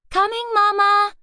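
high call down the hall. A narrator's reading. Louise answering her mother's second call, calling. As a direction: call to Mama from upstairs.

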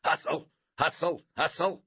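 loud, high coach's shout; punchy repeated sideline yell. A narrator's reading. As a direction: shout it like a coach driving the team. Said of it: "tweeted his whistle and shouted".

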